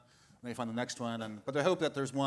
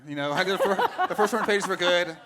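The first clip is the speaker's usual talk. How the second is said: talking through a real laugh, voice lifted high; 8 semitones higher, 13 dB louder than usual. Real, not acted. Author joking about his agent's pressure, laughing. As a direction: laugh your way through the line.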